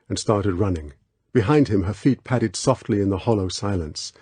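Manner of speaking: ordinary delivery, nothing marked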